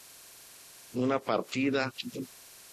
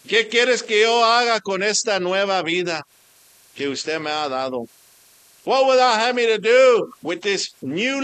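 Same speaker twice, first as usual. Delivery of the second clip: preaching at full force; 6 semitones higher, 6 dB louder than usual. Acted. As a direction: call out like a preacher on fire. Preaching appeal.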